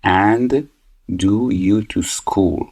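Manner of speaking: very slow and drawn out; slow teacher reading of the exercise; slow gapped read-aloud. Shown as a demonstration. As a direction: read the gapped line out slowly, word by word. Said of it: "reading the gapped line".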